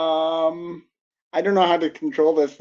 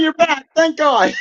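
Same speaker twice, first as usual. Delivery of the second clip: high, loud cry of relief; overjoyed he's back; joyful reunion; 12 semitones higher, 4 dB louder than usual. Real, not acted. Co-host returns after dropout.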